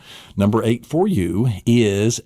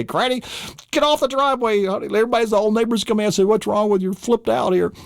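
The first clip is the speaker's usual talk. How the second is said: higher, urgent coaxing; flustered pleading; 11 semitones higher than usual. Pretend. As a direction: hurry granny up before the neighbours stare. Pulling granny off driveway.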